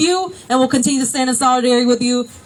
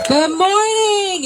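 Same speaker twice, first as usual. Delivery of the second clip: rally greeting; 6 semitones higher, slower than usual. Real, not acted.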